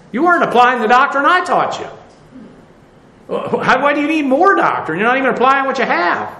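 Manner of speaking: indignant retort. Acted.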